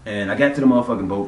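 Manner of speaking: ordinary delivery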